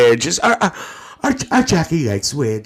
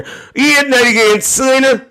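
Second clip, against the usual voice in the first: teasing question; 12 semitones higher, 3 dB louder than usual. Real, not acted. Teasing viewer.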